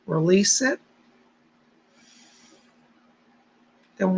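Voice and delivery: slow, calm meditation voice; guided meditation. Acted. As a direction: ease them into stillness. Leads blink meditation countdown.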